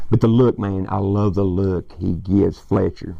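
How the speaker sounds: usual speaking voice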